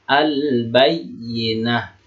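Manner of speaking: very slow "Al-Bayyinah", l sounded before b; clearly read lam. Shown as a demonstration. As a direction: stretch "Al-Bayyinah", holding the l and the yy. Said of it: "Itu namanya alif lam qamariyah".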